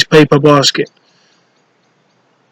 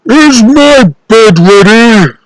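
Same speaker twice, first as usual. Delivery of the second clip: slow, loud, higher giant demand; giant's growl; 6 semitones higher, 5 dB louder, slower than usual. Acted. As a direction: growl it at bedtime. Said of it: "the giant would growl".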